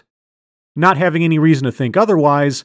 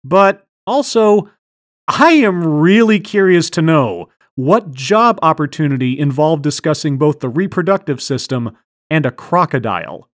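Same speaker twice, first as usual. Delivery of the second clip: normal talking voice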